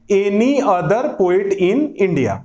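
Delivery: any other poet in India, very slow and even; slow dictation. Shown as a demonstration. Teacher dictating the corrected phrase to students. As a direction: dictate it word by word. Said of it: "इसी के लिए हम लोग वहां पर any other poet लगा देंगे".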